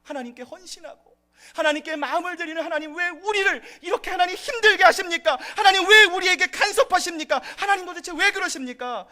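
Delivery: high, loud anguished complaint; complaining to God. Retold or imitated. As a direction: cry out to God in frustration, pleading. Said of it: "하나님께 이런 불평을 할 수 있습니다". (하나님께 높고 크게 부르짖는 불평)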